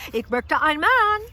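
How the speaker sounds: loud, high German call-out; hammed-up holler in German; mock demanding order. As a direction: belt out the German request. Mock phone order for a husband.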